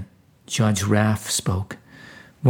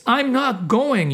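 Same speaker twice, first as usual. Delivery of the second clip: high, stubborn refusal; mock yell; 12 semitones higher, slower than usual. A narrator's reading. Soul refusing the mission, high stubborn yell. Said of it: "you yelled".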